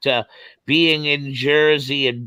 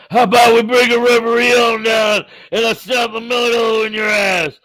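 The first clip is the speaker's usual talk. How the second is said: mock tough-guy challenge; 8 semitones higher, 4 dB louder than usual. Mocking a wrestler's challenge.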